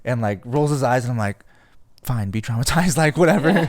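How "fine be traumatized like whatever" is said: high, loud, laughing "whatever"; laughing dismissal. Retold or imitated. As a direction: brush him off through a laugh. Friend refuses, speaker laughs.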